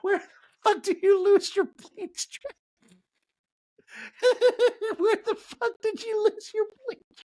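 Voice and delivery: high, cracking up mid-question; can't get it out for laughing. Real, not acted. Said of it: "laughing at own bit".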